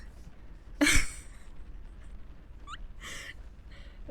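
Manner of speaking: real airy giggle; high airy laugh. Real, not acted. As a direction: giggle breathlessly. Laughing at the edit.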